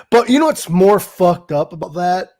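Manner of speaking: ordinary talk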